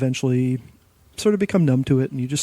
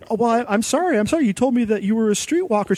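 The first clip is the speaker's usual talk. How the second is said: flustered apology; 9 semitones higher than usual. Retold or imitated. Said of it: "And she's like".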